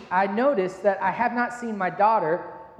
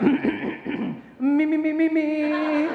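higher mock voice-warming "me, me, me"; man on the phone faking that he's awake, higher mock warm-up; vocal warm-up; 7 semitones higher, much slower than usual. Shown as a demonstration. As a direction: test your voice so you sound awake. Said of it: "get the sleepies off your voice".

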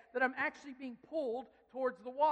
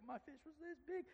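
mock fisherman's brag, higher and softer; boastful fisherman; 4 semitones higher, 11 dB quieter than usual. A fisherman telling his tall story, mock boastful. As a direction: put on a fish-story teller's voice. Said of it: "like, the story's like".

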